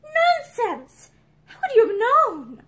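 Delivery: high, loud indignant outburst; angry. A narrator's reading. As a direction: bristle and deny it hotly. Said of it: "Pansy looked angry".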